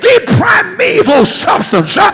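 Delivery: ringing call-and-response sermon; preaching. Acted. Pastor leading congregation call and response, rousing preaching. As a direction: drive each point home, then call for the answer.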